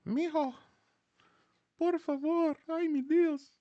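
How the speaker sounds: high, pleading Mexican grandma voice; Mexican abuelita. Retold or imitated. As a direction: beg like a heartbroken abuela. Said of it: "I'm gonna be like".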